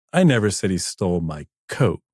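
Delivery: "coat" held back, then landed with emphasis; stress on COAT. Shown as a demonstration. DJ showing ways to say one line, stress on "coat". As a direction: save the weight for the final word "coat". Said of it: "this many ways to say this".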